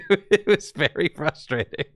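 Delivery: high-pitched, laughing through the words. Real, not acted. Caster agreeing with Twitch chat it was frustrating, laughing. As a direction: talk through a real laugh.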